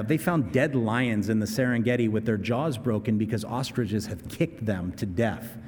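normal talking voice

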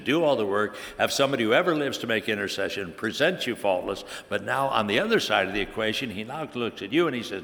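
ordinary delivery

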